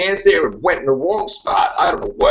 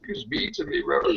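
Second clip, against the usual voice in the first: whiny tattletale; 13 semitones higher, 9 dB quieter than usual. Acted. Jack whines to River.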